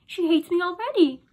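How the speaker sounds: put-on girl complaining loudly to her parents; upset cry. A narrator's reading. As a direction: wail it like a frightened child. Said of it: "I cried".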